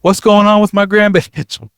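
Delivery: shrill high yell for Betty; grandmother yelling. Retold or imitated. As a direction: call loudly to your daughter far back in the plane. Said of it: "she was yelling".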